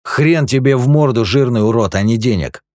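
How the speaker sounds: loud, cutting snap; curt retort. A narrator's reading. Eugene snaps back at fat Dudley, loud and cutting. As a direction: cut him off hard. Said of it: "отрезал Юджин".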